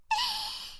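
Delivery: high giggle that falls away; a sly little laugh; mischievous giggle. Acted. Big sister deciding where to tickle her brother, sly high giggle.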